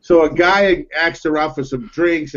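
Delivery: usual speaking voice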